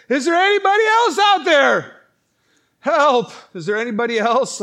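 high, very loud holler, easing at the end; hammed-up desperate yell; calling for help. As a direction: shout past the first answer for another rescuer. Man on cliff calls for others.

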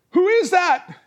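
high, loud shouted question; acted desperate holler; calling out. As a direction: shout up from the cliff. Man on cliff calls out.

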